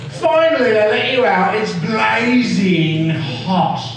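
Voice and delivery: Cockney sliding into Australian twang; hammed-up Australian demonstration; Australian accent. Shown as a demonstration. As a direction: slide from Cockney into broad Australian, ending high. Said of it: "that's how that accent happens".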